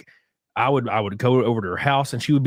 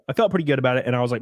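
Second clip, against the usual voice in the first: ordinary talk, nothing marked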